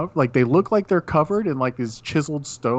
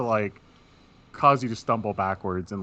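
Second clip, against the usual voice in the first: normal talking voice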